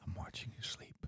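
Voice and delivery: soft, breath-only ASMR whisper; creepy whisper. Pretend. Creeping out the co-host with watching them sleep, creepy whisper. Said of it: "That is really creepy".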